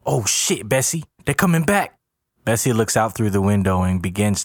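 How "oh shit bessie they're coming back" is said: high, urgent heads-up; pitched-up urgency; alarmed warning. A narrator's reading. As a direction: warn your partner in a rising panic. Lookout warns of men returning.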